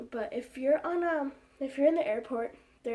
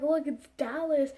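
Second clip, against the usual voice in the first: higher, louder insistence; higher, louder impatient correction; exasperated pointing out; 4 semitones higher, 6 dB louder than usual. Retold or imitated. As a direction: make her look at the obvious. Pointing out the screen to sister.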